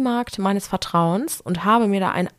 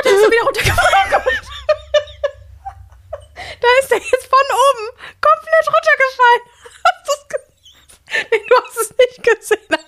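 laughing excitedly; 5 dB louder than usual. Real, not acted. Bug falls, she laughs loudly.

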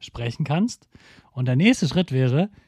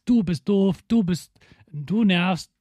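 put-on high, childish "Du bist doof", slowing down; mock you-statement jab; mock insult; 6 semitones higher, slower than usual. Shown as a demonstration. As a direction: blame them like a sulky kid. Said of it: "als wenn du immer sagst".

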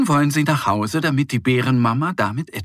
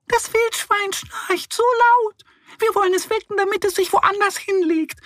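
explaining mice. Acted. Forest mice explain to the animals, squeaky. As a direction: squeak it out earnestly. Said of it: "erklären die Mäuse".

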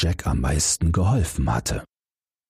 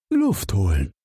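frog voice; 3 semitones higher than usual. A narrator's reading. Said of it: "antwortete der Frosch".

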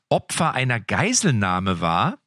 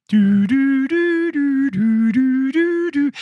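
hold beeps; 12 semitones higher, 4 dB louder, much slower than usual. Retold or imitated. Mocking a phone hotline queue.

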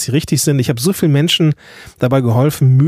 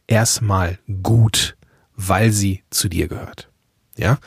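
reassuring; 6 semitones lower, slower than usual. Real, not acted. Coach reassuring listeners.

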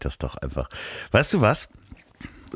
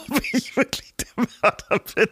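laughing; 13 semitones higher than usual. Real, not acted. Laughing at own murder dream.

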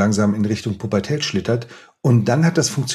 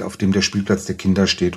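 normal talking voice

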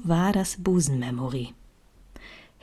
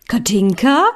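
mother calling up the stairs, louder and higher with a rising question; calling upstairs; 5 semitones higher, 6 dB louder than usual. A narrator's reading. Mother calls her daughter from downstairs, a loud rising call. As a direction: call the name up to the next floor. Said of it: "schallte die Stimme meiner Mutter nach oben".

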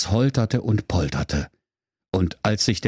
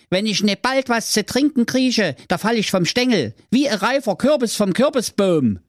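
raised brother and very high sister voices; sibling voices; 9 semitones higher than usual. Acted. Brother and sister talking on the road, high character voices.

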